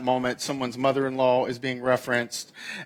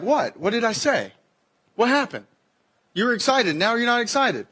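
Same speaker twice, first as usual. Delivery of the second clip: higher, puzzled protest; confused; 7 semitones higher than usual. Acted. Partner baffled by the sudden chill, protesting. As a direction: ask what went wrong, baffled. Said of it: "one or the other's like".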